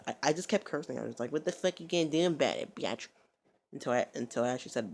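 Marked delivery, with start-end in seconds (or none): 1.3-3.1 higher, louder goading with odd vowels; mock taunt. Kept cursing at her to taunt, mock defiant. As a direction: stretch "bitch" into "byatch".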